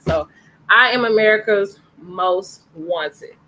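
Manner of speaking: ordinary delivery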